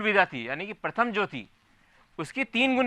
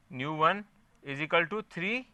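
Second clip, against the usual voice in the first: slow dictation; 3 dB quieter, much slower than usual. Shown as a demonstration. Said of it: "teacher dictating a formula".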